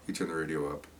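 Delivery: soft, low undertone. Retold or imitated. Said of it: "I'll lower my, I'll keep my voice high".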